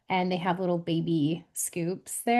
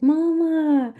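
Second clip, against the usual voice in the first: lightly voiced toddler call, higher and louder; toddlers calling Mama; 7 semitones higher, 6 dB louder than usual. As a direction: call out like an excited little kid. Retells toddlers calling out to mother.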